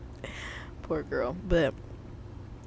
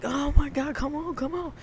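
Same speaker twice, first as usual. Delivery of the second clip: high, pleading mock panic; retold panicky urging; 8 semitones higher than usual. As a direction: urge the car on in a raised pitch. Frantic while car won't start.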